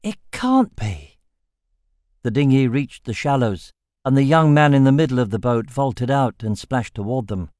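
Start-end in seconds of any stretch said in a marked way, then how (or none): 0.0-1.2 high, slow, breathless gasp; gasped disbelief. A narrator's reading. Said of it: "Sasha gasped".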